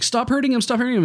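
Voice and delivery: high-pitched imitated frantic plea; retold kid's protest, pitch jumping up; pleading little brother. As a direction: plead urgently as an upset kid. Imitates upset brother pleading with man.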